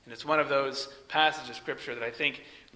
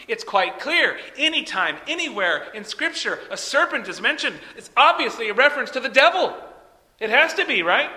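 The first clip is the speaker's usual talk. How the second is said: higher-pitched sarcastic conviction; mock certainty; 5 semitones higher, 3 dB louder than usual. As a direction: argue it as if blindingly obvious, tongue in cheek. Mock agreement before saying it's wrong.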